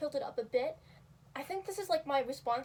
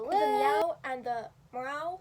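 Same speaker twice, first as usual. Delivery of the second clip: drawn out meows; 7 dB louder, much slower than usual. Shown as a demonstration. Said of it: "let me see the".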